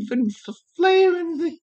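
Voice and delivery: usual speaking voice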